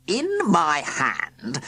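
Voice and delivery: very slow, drawn-out opener; stern villain. A narrator's reading. Sir Quincy starting over, very slowly. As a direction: stall with slow gravity. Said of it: "began Sir Quincy".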